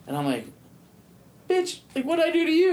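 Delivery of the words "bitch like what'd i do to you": very high falsetto mock offence; incredulous retort. Inmate asking disgusted officer what he did, falsetto disbelief. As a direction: squeak it out, baffled and offended.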